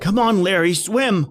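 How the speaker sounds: frantic shout from the shore; urgent shouting. Acted. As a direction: drive him to swim for it.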